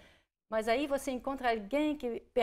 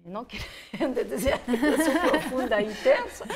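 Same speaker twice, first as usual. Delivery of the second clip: laughing through the words, louder; 2 semitones higher, 8 dB louder than usual. Real, not acted. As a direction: giggle it out as a modest joke. Self-deprecating joke while laughing.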